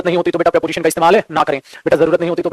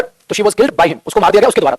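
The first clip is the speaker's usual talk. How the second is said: ordinary delivery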